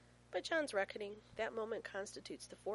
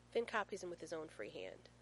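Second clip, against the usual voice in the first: ordinary delivery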